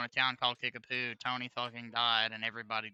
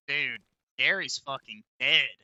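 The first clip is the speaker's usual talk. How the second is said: ordinary talk, nothing marked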